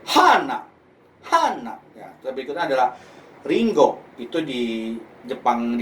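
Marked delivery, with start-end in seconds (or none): 0.1-1.7 Osaka-accent "hana", high Ha falling sharply. Shown as a demonstration. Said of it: "Di Osaka bisa". 3.5-4.0 Kanto-accent "ringo", milder, higher pitch. Shown as a demonstration. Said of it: "di Kantonya itu adalah apel".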